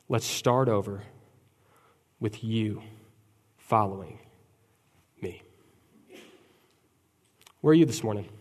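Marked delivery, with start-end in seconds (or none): 0.1-5.4 soft, slow, falling lines; Jesus softly calling Peter back; gentle invitation. Acted. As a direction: invite him back tenderly. Tender closing invitation.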